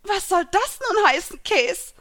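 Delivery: high, loud wounded outburst; offended fish woman demanding an answer; deeply hurt. A narrator's reading. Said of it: "fragte die Fischfrau zutiefst getroffen".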